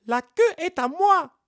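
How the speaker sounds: very high monkey insisting; high monkey protest; indignant monkey. Acted. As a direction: claim the tail back. Monkey argues in court.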